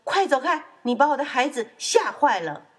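high, loud, indignant mother duck; angry shout. Acted. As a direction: shoo someone away furiously. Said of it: "鸭妈妈气坏了， 大声说". (气坏了，大声呵斥)

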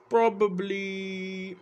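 "probably" stretched very slowly; "probably" pulled out long and musing; drawn-out probably. Real, not acted.

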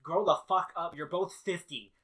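loud, mildly fed-up scolding; mock exasperated Diantha. Champion scolding two squabbling fifty-year-olds, mock exasperation. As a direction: tell them off loudly, half in earnest. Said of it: "Diantha is like".

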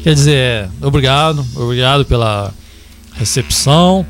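forced low manly voice; deep macho voice. Acted. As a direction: drop into a fake macho bass. Corrects himself into macho voice.

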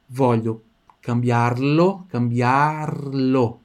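cambiarlo stretched out very slowly; very slow demo. Shown as a demonstration. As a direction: drag each syllable for the learner. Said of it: "teacher models slowly". (bem devagar, esticando cambiarlo)

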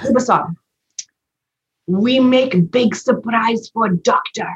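high, slow, hammed-up Japanese accent; bad Japanese accent. Patient retells her healer's big announcement, bad Japanese accent. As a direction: imitate a healer in a badly faked Japanese accent. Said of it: "it's a really bad Japanese accent".

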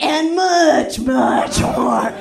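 hammed-up sexy line read; Fancy Ray's sexy read. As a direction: purr it like a seductive director. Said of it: "he kept directing me".

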